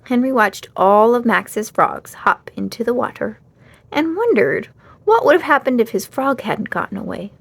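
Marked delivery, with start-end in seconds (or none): none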